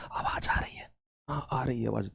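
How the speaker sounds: whisper, mimicking secretive late-night earphone chatter; imitated hushed talk. Teacher mimics students' night-time earphone calls, whispering. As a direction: drop to a barely audible mock whisper. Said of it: "इतने पास पास से बोलते हो".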